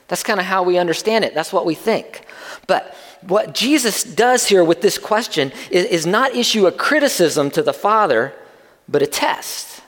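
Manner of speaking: ordinary talk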